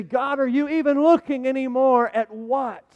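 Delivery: loud, high, drawn-out challenge; crying out to God. Acted. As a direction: demand God's attention at a slow near shout. Congregation's doubting cry to God.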